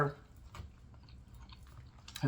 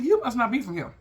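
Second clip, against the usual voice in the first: higher pitched teasing jab; 7 semitones higher, 3 dB louder than usual. Retold or imitated. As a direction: tease him like an outsider. She teases the butcher.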